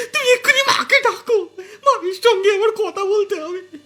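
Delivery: a daughter crying in shame, half laughing; tearful voice. Acted. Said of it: "কাঁদো কাঁদো স্বরে তার মেয়ে বলল".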